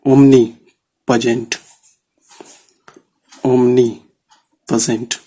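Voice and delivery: omnipresent dragged out very slowly; teacher dictating a word to a shorthand class; slow dictation. Shown as a demonstration. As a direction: stretch every syllable of omnipresent. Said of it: "जैसे देखो".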